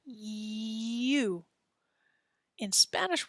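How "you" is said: "You" with a clear y glide before the oo; English "You", starting on a y sound. Shown as a demonstration. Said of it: "Do you hear that E sound at the beginning".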